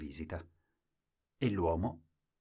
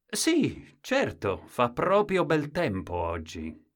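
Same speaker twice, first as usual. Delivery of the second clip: mock polite; 7 semitones higher, 3 dB louder, slower than usual. Man's forced politeness.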